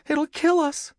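put-on very high little-boy voice; frightened warning. A narrator's reading. Tom warning his sister about the ghost, frightened. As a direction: warn in a high, scared pitch. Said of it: "he warned".

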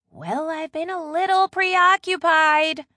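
loud, high and slow; said loudly. A narrator's reading. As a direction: project pointedly for someone else to overhear. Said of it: "Maddie said loudly".

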